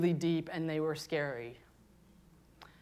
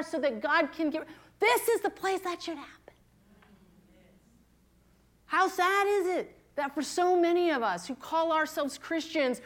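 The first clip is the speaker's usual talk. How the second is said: full-force preaching; 7 semitones higher than usual. Acted. Pastor urging the women to share brokenness, preaching with passion. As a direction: preach it out to the whole room.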